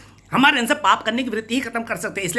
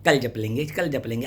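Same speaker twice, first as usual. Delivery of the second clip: mock procrastination; 6 semitones lower than usual. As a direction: imitate a lazy brush-off, pitched down. Acting out devotees putting off chanting.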